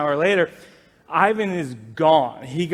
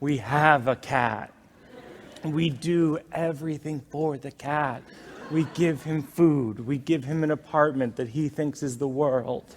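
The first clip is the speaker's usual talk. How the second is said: mock-solemn explanation; 4 semitones lower, 5 dB quieter than usual. Husband sitting up to answer wife, mock-solemn. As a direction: lay it out gravely and patiently.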